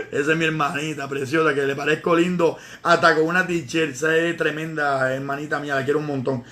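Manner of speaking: con ternura, más grave y suave; cariñoso. Real, not acted. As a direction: habla con cariño sincero de su hermanita. Habla con cariño de su hermana. (elogia a su hermana con cariño, en voz más grave y suave)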